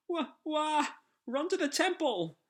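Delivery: very high cartoon wailing; shrill comic panic; panicked flee. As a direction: scream while running away. Fleeing the monster.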